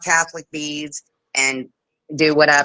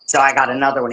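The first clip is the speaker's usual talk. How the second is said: ordinary delivery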